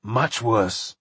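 mildly choked, subdued. A narrator's reading. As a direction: let the dread weigh on it a little. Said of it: "said Bert in a choked voice".

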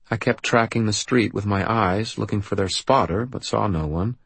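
ordinary delivery, nothing marked

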